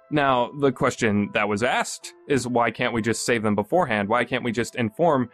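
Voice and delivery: ordinary delivery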